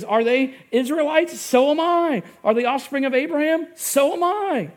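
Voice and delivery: building heated outburst; riled-up Paul. Retold or imitated. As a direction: read Paul's boast like his blood is boiling. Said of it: "riled up, his blood boiling".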